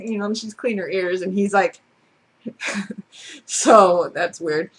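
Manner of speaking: ordinary talk, nothing marked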